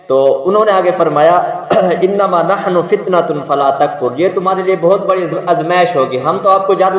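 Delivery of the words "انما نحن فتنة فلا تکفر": Quran recitation. Acted. Angels' words quoted from Quran.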